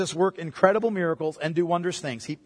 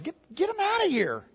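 higher, dismissive shoo-away; brushing-off disciple; 7 semitones higher, 4 dB quieter than usual. Retold or imitated. Disciples urging Jesus to dismiss the crowd, impatient. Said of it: "they say to Jesus".

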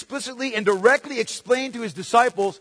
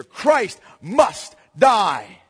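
MUST hammered home, very slow and heavy; emphatic and slow; 3 dB louder, much slower than usual. Acted. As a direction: drive each word in like a nail. Key phrase hammered slowly.

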